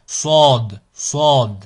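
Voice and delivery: Sod with a heavy, dark emphatic S; emphatic, grave S. Shown as a demonstration. Teacher demonstrating the emphatic letter Sod, heavy and dark. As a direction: hit Sod firmly with a backed, dark sound. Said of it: "un son emphatique qui est grave".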